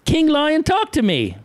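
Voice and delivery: put-on high child's shout calling her mommy; excited child. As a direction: call out to mom like a thrilled kid. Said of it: "ran over and said".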